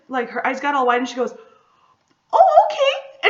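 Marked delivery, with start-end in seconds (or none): 2.3-3.3 very high, loud startled oh okay; flustered. Retold or imitated. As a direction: squeak it out, caught off guard. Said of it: "turns around totally flustered".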